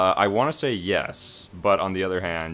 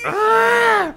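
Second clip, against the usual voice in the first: frustrated yell; 9 dB louder than usual. Real, not acted.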